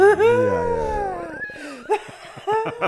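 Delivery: very high, loud, drawn-out laughing squeal; laughing hard. Real, not acted. Teasing the interviewer about phone history, laughing hard. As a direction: squeal the words out through laughter.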